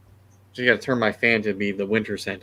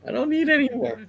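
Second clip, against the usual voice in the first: high, squeaky protest. Real, not acted. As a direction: squeak it out, much higher than usual. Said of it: "He says in a squeaky voice".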